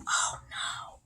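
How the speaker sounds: whispered oh-no; hushed dismay. Pretend. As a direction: whisper it in mock alarm. Hushed reaction to sneeze.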